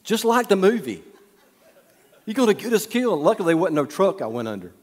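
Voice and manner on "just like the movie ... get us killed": higher, half-laughing alarm; playing his frightened wife; mock panicked wife. Brenda begging her husband to move over, mock panic. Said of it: "Brenda's like".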